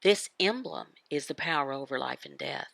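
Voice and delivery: normal talking voice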